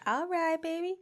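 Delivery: high, soft coo with a rising end. Acted. Actress answering rival director's offer, flirty coo. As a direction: coo it softly upward. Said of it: "Did not expect for you to sound like that".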